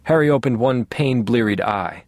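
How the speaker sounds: ordinary delivery, nothing marked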